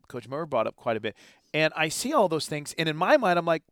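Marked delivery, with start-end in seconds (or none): none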